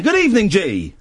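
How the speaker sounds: ordinary delivery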